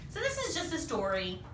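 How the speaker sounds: plain reading voice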